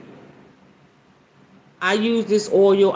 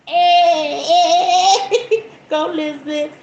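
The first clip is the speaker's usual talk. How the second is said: much higher, louder whooping with laughter; excited cheering; 9 semitones higher, 4 dB louder, slower than usual. Real, not acted. Cheering Elizabeth's answer.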